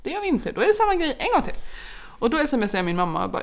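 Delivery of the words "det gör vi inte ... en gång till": put-on a much higher, stubborn little-girl voice; mock the three-year-old digging in her heels; defiant toddler. Toddler refusing the jacket. (härmar en trotsig treåring med mycket ljusare röst)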